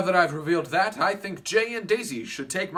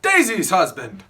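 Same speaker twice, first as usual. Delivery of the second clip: boorish Tom Buchanan; 5 semitones higher, 5 dB louder than usual. Acted. Plays Tom Buchanan throughout.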